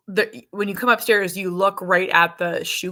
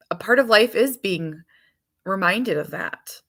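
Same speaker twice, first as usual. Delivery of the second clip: ordinary delivery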